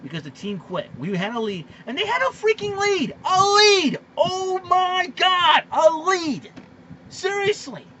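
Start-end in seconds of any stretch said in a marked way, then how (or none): 1.9-7.9 outraged yell. Real, not acted. Ranting that the team quit.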